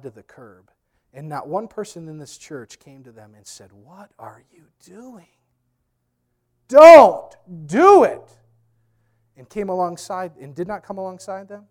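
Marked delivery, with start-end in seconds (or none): 3.7-5.3 slow, hushed, drawn-out question; hushed confrontation. Acted. Pleading with a straying church member. 6.7-8.4 very slow, high, booming shout; shouted warning. Acted. Acted plea to stop someone.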